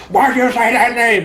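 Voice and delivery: mock a masked hero's tormented demand; put-on superhero voice; modulated Batman voice. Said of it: "his voice is modulated".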